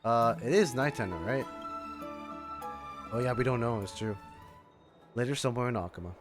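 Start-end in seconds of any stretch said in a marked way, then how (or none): none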